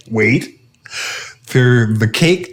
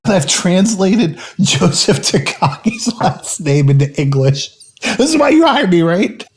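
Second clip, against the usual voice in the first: mock smug Theo; 7 semitones higher than usual. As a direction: brag like a smug nerd. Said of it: "Theo's all like".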